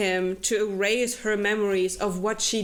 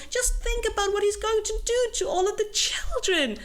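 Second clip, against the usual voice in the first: much higher, hammed-up urgent girl; mock Isla; 12 semitones higher than usual. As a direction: pitch it way up and plead dramatically. Isla voiced in the retelling.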